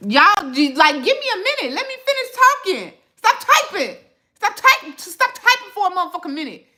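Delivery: high-pitched, exasperated demand; exasperated scolding. Real, not acted. Streamer telling chatters to let them finish talking, exasperated. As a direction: plead crossly for a break, pitch way up. Scolding viewers for typing over her.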